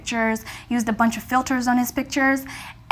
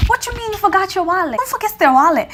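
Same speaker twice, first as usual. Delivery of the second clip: annoyed disbelief; 7 semitones higher than usual. Retold or imitated. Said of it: "I was very pissed off".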